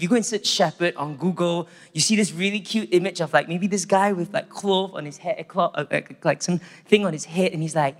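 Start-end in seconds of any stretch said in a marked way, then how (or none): none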